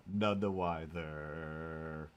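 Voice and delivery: "None the wiser" stretched slowly, each word drawn out; lingering slow echo. Pretend. Repeats the phrase slowly.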